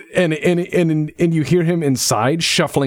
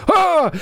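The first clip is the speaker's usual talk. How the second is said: high sudden squawk of alarm; startled wizard yelp; 3 dB louder than usual. Acted. Wizard sees the armed party at his door, high yelp. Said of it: "and he goes".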